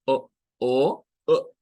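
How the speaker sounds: O with a glottal stop; O cut short at the throat; O, O, O clipped in the throat; glottal O sound. Shown as a demonstration. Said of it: "hacerle O".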